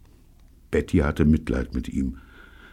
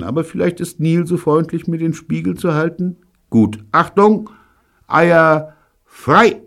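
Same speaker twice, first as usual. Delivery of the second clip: David showing off; 9 semitones higher, 5 dB louder than usual. Acted. David directs the trick.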